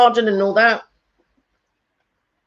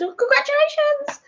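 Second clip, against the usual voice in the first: high sing-song congratulations. Retold or imitated. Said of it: "I called him up and I said".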